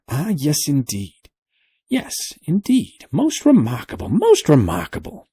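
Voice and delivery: put-on high, fluttery old man's voice; fussy old professor. A narrator's reading. Professor apologizing to the sailor, fussy and high.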